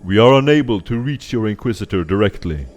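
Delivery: booming voice. Acted. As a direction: proclaim it like a giant.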